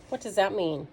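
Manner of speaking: usual speaking voice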